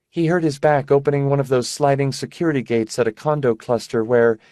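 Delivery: lightly voiced sick young woman, high; airy, distracted girlish voice; high fragile young woman. Rebus voiced by narrator.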